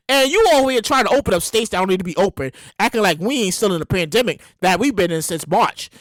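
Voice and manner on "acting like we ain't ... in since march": ordinary delivery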